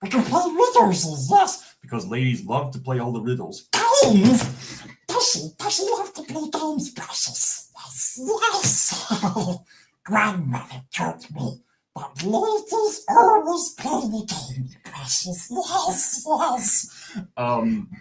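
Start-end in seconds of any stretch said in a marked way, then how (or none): 0.0-1.8 higher hissing creature voice; eager and sly, like Gollum. Acted. Gollum pitching riddles as a date, eager. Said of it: "I don't think Gollum would". 4.0-17.2 much higher hissing creature voice; sly and wheedling, like Gollum. Acted. Gollum reciting grandmother's advice on ladies, sly.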